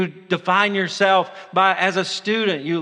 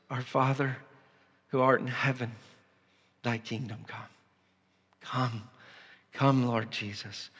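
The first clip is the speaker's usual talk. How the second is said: hushed prayer; 4 semitones lower, 8 dB quieter than usual. Acted. Preacher leading the church in hushed prayer. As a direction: pray it under your breath.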